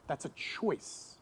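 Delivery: slow, near-whispered aside; hushed emphasis. Real, not acted. Pastor quietly driving home that offense is a choice. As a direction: drop to a breath to make the point. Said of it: "softly stressing the point".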